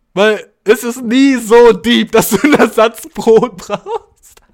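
lauter, langsam, vor Lachen; amüsiert gedehnt; lachend. Real, not acted. Laughing about Ersatzbrot. (lachend und gedehnt erzählt)

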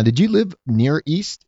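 usual speaking voice

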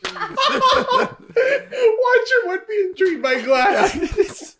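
giggling through the words; laughing delight at the detail. Real, not acted. Laughing while praising the story detail. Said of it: "That's such a great detail".

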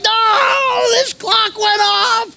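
high, crying shout of protest; retold shouting. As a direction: yell it up high, pleading with the ref. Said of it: "again, being very calm".

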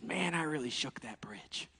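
slow, whispered little mouse; slow whispered punchline. Acted. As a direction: whisper it like a proud tiny mouse.

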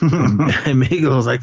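ordinary delivery

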